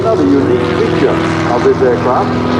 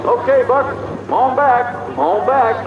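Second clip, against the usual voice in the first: calling out high; 9 semitones higher, 3 dB quieter than usual. Retold or imitated. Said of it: "and we say".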